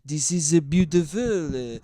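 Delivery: put-on slow, halting French accent; drawn-out bad French accent. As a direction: do a terrible French tourist accent. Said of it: "I'm fucking terrible at French accents".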